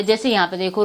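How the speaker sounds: normal talking voice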